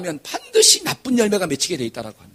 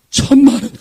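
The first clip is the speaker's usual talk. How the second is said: blurted confession; 5 semitones higher, 8 dB louder than usual. Retold or imitated. Son admits huge loss.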